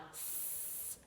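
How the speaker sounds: S said as a long unvoiced hiss; hissing S sound. Shown as a demonstration. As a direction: hiss the S like a snake, no voice. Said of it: "Make that sound".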